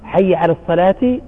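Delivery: "الصلاة" pronounced "صلاتِ" with the kasra; "الصلاة" ending in a spoken "ti". Shown as a demonstration. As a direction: sound the taa marbuta as t with kasra. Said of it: "نكسرها وننطق التاء المربوطة تاء". ("الصلاة" بالتاء المكسورة)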